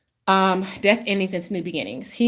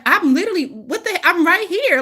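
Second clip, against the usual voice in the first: exasperated Aquarius; 10 semitones higher, 5 dB louder than usual. Retold or imitated. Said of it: "Aquarius is like".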